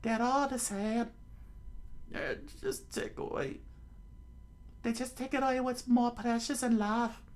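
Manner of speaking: high character voice, angry; angry Nami. Acted. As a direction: spit out the word pirates with disgust. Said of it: "Nami said with anger".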